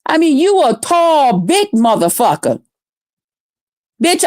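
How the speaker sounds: normal talking voice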